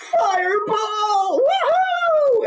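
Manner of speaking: very high, loud gleeful cartoon yell; over-the-top ecstatic shout; thrilled whooping. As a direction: scream it with huge drawn-out joy. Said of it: "This fellow is so happy".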